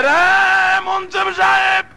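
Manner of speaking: high, ringing chanted cry; impassioned reproach. Acted. Man reproaches the munsif for his scolding, high chanted cry. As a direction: cry out the accusation, drawing out each ending.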